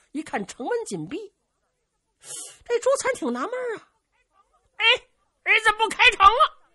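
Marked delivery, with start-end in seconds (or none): 4.8-6.6 puzzled exclamation. Acted. Zhu Can puzzled at closed gate.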